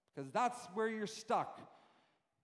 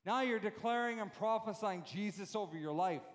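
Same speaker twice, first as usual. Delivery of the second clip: normal talking voice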